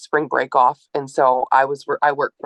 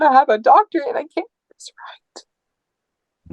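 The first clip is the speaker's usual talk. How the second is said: despairing whisper; 10 semitones higher than usual. Retold or imitated. Retold despair over motherhood.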